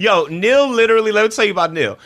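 high and loud, bubbling with laughter; excited teasing. Real, not acted. Telling the crew about her crybaby groomer, high and loud. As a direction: tell it like the best gossip.

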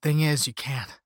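ordinary delivery

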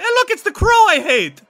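high, loud vampire voice; hammed-up high-pitched vampire cry; vampire calling out. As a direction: point someone out with delighted recognition. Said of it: "points at Stir Fry and says".

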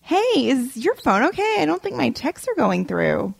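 high, falsely sweet inquiry; passive-aggressive. Acted. Date complaining about unanswered texts, high passive-aggressive lilt. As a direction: lilt it up as if innocently checking. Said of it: "Next one".

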